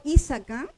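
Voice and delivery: Isaka with the force on the opening "I"; stress on first syllable. Shown as a demonstration. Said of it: "entonces acentuó la primera".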